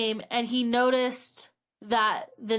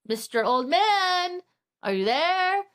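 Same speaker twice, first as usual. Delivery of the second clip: higher, slow sing-out call downstairs; 7 semitones higher than usual. Retold or imitated. Man looking for old neighbor downstairs, calling out. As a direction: call down the stairs, stretching the name.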